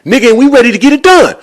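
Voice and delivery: fast, high, loud bluster; talking all tough. Retold or imitated. Seller squaring up to the mortgage man, talking tough. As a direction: rattle out an acted challenge. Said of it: "Mark's talking all tough".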